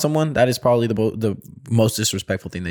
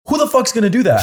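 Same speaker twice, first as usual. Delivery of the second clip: high-pitched mock outrage; Hector's indignant challenge; 11 semitones higher than usual. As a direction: imitate a player daring anyone to try. Retelling Hector's angry challenge.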